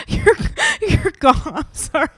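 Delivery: laughing through the words; giggling at own joke. Real, not acted. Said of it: "I'm laughing at my own joke".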